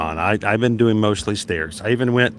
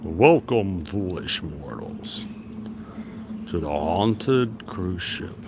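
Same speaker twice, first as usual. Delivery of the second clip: dark lord; 2 semitones lower, much slower than usual. Pretend. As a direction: welcome them like a haunted house host. Spooky ship, Haunted Mansion greeting.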